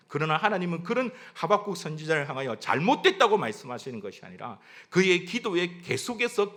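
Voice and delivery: ordinary talk, nothing marked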